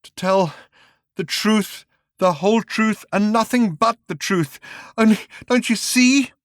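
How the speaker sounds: high, rising to a loud plea; growing desperate voice; drugged man, building. Acted. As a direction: climb toward a cry.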